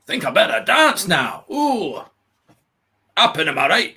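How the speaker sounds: failed attempt at Welsh; badly faked Welsh accent. Shown as a demonstration. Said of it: "I can't do Welsh".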